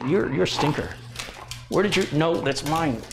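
high teasing voice at the dog; playful scolding. Real, not acted. Owner teases the dog snatching her mic, high and playful.

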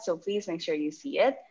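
ordinary talk

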